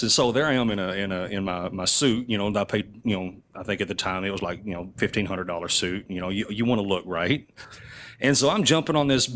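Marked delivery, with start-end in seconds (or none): none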